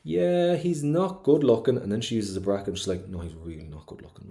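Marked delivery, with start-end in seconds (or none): 0.0-1.8 high, loud, drawn-out concession; breezy open admission. Acted. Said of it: "she's being really, really honest". 3.1-4.3 dropped, much quieter aside; poet's private aside, said under the voice; confiding inner thought. Acted. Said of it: "you can really hear her internal thoughts".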